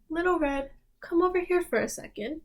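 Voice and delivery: a hint of a caring mother calling out. Mother calls Little Red.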